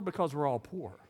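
ordinary talk, nothing marked

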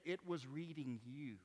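usual speaking voice

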